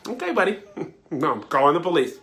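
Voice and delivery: mock Permit Patty.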